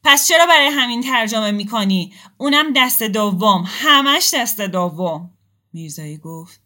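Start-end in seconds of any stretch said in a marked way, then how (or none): 0.0-5.3 loud, high, bitter shout. Acted. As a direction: yell it, slowing and hammering the last words. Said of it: "مقداد داد زد".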